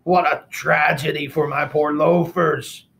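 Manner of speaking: pig suitor. Acted. Pig laments his shoes.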